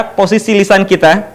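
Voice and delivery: ordinary talk, nothing marked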